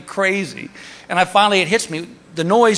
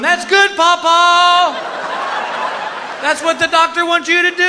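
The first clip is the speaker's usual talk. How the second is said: cheering relative impression; 12 semitones higher than usual. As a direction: praise Papa in a high mock voice. Mimics relative encouraging farting patient.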